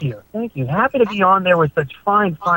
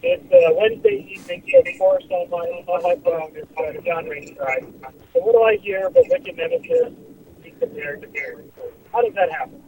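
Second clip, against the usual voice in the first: caller on speakerphone; distant tinny phone line; phone speaker. Real, not acted. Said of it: "we can barely hear you. If you're on speakerphone".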